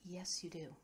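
hushed, near-whispered reply. A narrator's reading. Quinn answers Kova about the moon, hushed. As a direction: drop almost to a whisper.

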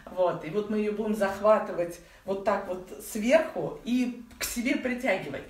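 ordinary delivery

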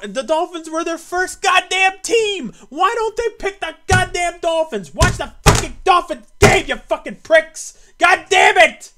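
outraged howl at Florida; furious yelling. Real, not acted. Fan yelling that Florida skipped the Dolphins, furious. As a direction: scream it with full rage. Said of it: "excuse the yelling".

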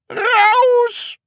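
high, loud enraged yell; furious scream. Acted. Teacher red-faced at torn sleeve, screaming. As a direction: scream the pupil out of the room. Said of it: "schrie Herr Studienrat Groll".

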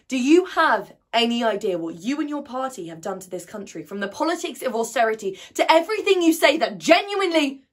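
loud, high tirade, rising in pitch and force; angry challenge. Acted. Young activist challenging the Prime Minister, angry and loud. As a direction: demand an answer, building to a furious peak.

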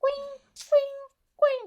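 small broken sobs before speaking; whimpering sobs. Acted. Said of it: "ಯಾಕೆ ಅಳ್ತಾ ಇದೀಯಾ". (ಸಣ್ಣಗೆ ಬಿಕ್ಕಳಿಸುವ ಅಳು)

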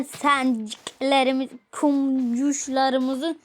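very slow, drawn-out kumcuşlarımızı; slow motion. Shown as a demonstration. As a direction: stretch kumcuşlarımızı out syllable by syllable. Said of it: "tatlı bir ad bulmam gerekiyor".

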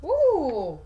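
ordinary delivery

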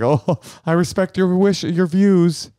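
high-pitched mock-respectful voice; mock deference. Showing wife the other option, high mock deference. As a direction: pretend to respect their views, pitched high. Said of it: "To be like".

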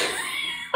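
high squeal of laughter; a real high-pitched laugh; laughing burst. Real, not acted.

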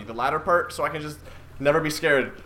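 ordinary talk

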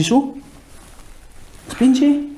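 sharp high call to push harder; coach's sudden push cue; urgent push command. Real, not acted. Therapist urging patient to push.

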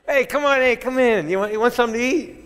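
a jovial Italian uncle inviting you in; high eager welcome, rushing the food offer; Al Pacino welcoming. Retold or imitated. Pacino voiced welcoming him in.

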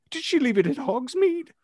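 ordinary delivery, nothing marked

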